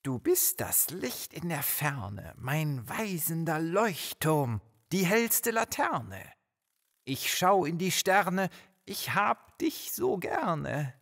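dreamy, high and slow recitation; hammed-up romantic longing; lovestruck poet. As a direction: recite a love poem with swooping, drawn-out words. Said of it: "Ira ist voll verknallt / romantisches Gedicht".

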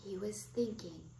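plain reading voice

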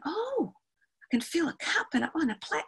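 put-on soft, high child voice; high, hushed wonder; child discovering. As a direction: play a pupil finding a surprise by touch. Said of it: "Natalie goes in there and she's feeling".

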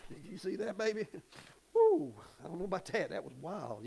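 soft, low mock astonishment; quiet, low, amazed aside; hushed amazement. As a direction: whisper it like a stunned bystander. Imitating onlookers whispering amazed.

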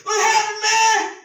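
high, loud, overfamiliar hail; cocky pastor greeting his pal; loud buddy greeting. Acted. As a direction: call out like he's your old homeboy. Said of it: "he go".

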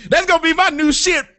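normal talking voice

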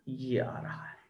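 low, near-whispered mutter; murmured aside. Real, not acted. Teacher murmuring while pointing out hexa to students, murmured aside. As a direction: mutter it very softly, almost to yourself.

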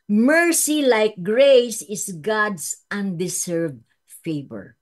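normal talking voice

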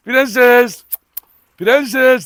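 loud call across the yard, falling at the end. Real, not acted. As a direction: call her name loudly so she comes. Calling the dog from afar.

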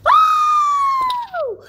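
long, loud high scream; anguished scream. Real, not acted. Player fails again, anguished high scream. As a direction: howl out at top pitch.

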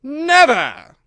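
high, loud outburst of rage; enraged queen's shout; furious. A narrator's reading. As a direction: explode on the single word. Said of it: "said the queen furiously".

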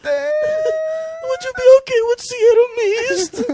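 very high, slow, drawn-out squeal of a question; loud mock falsetto, stretched out; high-pitched worker. Worker offering a customer another soda, high-pitched.

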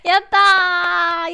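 loud, slow happy yay; drawn-out cheer. Real, not acted. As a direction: stretch the yay out in triumph. Celebrating voice fixed. (配信者が声が直って喜ぶ、伸ばした歓声)

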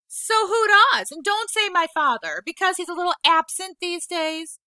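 loud, high, bitter retort; angry outburst. Acted. Boy lashing out at the old woman, loud and bitter. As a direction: snap back at her, hurt and furious. Peter lashing out about father.

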